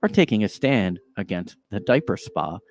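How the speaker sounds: hammed-up dramatic news narration; podcast news voice. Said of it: "this is basically how they talk".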